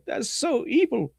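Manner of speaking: high, slow cartoon villain whine; Mojo Jojo voice. Mojo Jojo calling something evil, high and slow. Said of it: "Mojo Jojo going".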